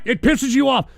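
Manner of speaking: usual speaking voice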